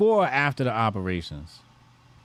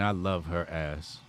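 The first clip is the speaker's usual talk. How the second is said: ordinary talk, nothing marked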